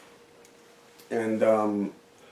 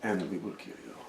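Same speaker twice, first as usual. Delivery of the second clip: low, soft menacing Taken line; a quiet, menacing promise; mock Taken threat; 3 semitones lower, 13 dB quieter than usual. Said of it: "We'll find you".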